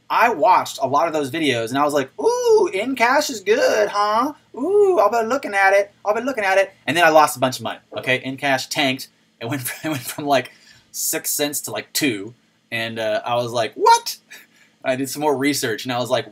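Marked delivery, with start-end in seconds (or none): 2.2-6.7 high, gullible cooing; mock intrigued. Streamer mimicking himself falling for hype videos, mock intrigued. 13.7-14.2 very high, loud yelp of disbelief; shocked. Retold or imitated. Streamer replaying reaction to his coin tanking, high shocked yelp.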